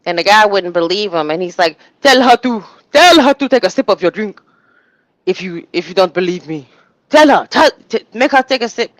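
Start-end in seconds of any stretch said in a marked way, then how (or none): 2.0-8.9 put-on high, loud Nigerian-accented warning; urgent Nigerian accent, raised pitch. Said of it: "Vicky with the African accent".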